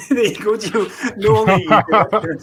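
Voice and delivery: bright laughing praise. Real, not acted. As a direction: cheer him on through laughter. Host laughing, congratulating.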